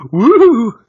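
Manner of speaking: loud high woo; high ghost whoop. Pretend.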